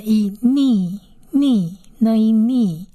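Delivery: "呢，一，ni" slowly, n plus ee into nee; drawn-out nee for "ni"; long vowel blend. Shown as a demonstration. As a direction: split the sounds, then hold "ni". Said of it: "长义的音".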